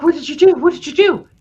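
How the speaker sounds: high, loud imitation of demanding; mock frantic accusation. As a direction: fire it out high and loud, acting him out. Acts out his frantic accusation on emerging.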